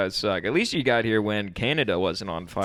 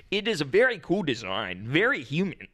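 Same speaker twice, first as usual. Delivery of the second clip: imitated odd foreign stresses, higher pitch; phonetically learned English; 5 semitones higher than usual. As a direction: mimic English learned by sound, stresses all wrong. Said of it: "the emphasis on words makes it sound like he's, like, saying it backwards".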